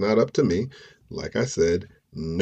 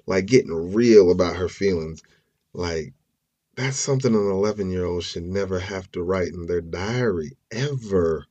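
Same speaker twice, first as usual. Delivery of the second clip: ordinary talk, nothing marked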